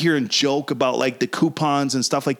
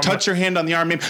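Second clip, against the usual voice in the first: ordinary delivery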